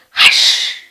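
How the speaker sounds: unvoiced hush. Shown as a demonstration. Teacher hushing so the baby can sleep. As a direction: shush gently so the baby sleeps. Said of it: "Baby is sleeping".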